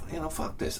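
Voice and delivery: soft fed-up mutter; muttered to yourself, giving up. Retold or imitated. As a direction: say it quietly, giving up. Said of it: "So I'm like".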